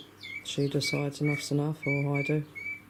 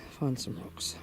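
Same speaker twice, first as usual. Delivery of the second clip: soft whispered reminder; whisper to self; 2 semitones lower, 6 dB quieter, slower than usual. Real, not acted. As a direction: whisper it to yourself. Muttering to herself.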